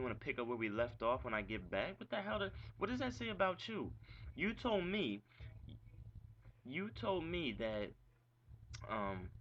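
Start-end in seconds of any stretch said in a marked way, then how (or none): 2.0-7.9 high-pitched, disbelieving outburst; worked up and incredulous; indignant outrage. Real, not acted. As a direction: voice jumping up in frustration. Indignant rant at his ex.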